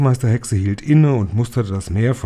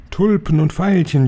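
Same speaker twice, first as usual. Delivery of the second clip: higher, delighted cheer; higher, gleeful cheer; cheering crowd; 7 semitones higher, 3 dB louder than usual. Acted. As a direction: echo it back like an eager fan crowd. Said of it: "jubelten die Zuhörerinnen".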